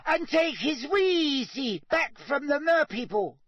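squeaky, high-pitched insistent elf; Dobby. A narrator's reading. Elf insisting Harry can do the task, squeaking. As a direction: squeak it with utter conviction. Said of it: "squeaked the elf".

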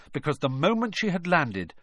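plain reading voice

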